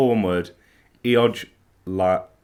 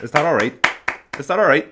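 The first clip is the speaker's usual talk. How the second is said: louder, higher Belfast accent; 5 semitones higher, 5 dB louder than usual. Shown as a demonstration. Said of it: "my Belfast accent".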